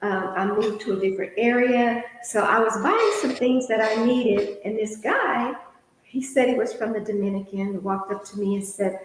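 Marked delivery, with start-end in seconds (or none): none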